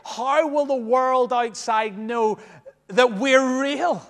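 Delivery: climbing rhetorical challenge to the church; rising plea. Real, not acted. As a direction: build the question to a peak. Preacher's rising rhetorical question.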